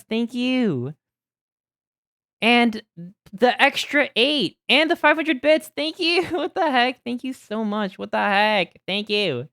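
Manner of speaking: high, loud overjoyed thank yous; excited thanks. Real, not acted. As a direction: gush thanks to chat. Thanking donors, hyped.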